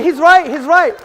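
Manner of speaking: shouted, each right hit harder; loud retort. Real, not acted. Answering a heckler in the hall, loud repeated shout. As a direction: shout over the crowd's noise.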